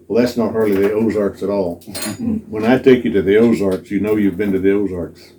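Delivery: ordinary delivery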